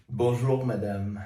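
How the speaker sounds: low, mock French greeting; French accent. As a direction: croon it like a suave Frenchman. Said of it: "French, you are embarrassing yourself".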